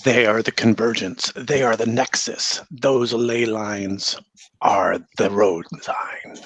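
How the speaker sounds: slow, hushed villain menace; slow theatrical villain menace. Acted. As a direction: draw out each word like a sinister hag. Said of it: "She says".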